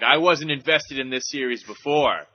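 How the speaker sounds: loud, emphatic fan declaration; hammed-up competitive outburst; mock fired-up. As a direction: declare a playful grudge, punching it loudly.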